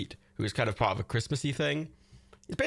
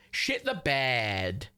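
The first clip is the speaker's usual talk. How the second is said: "Shit the bed" stretched very slowly; slow, level repeat of "Shit the bed"; slow drawn-out; much slower than usual. Real, not acted. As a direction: savour each word, dragging it out.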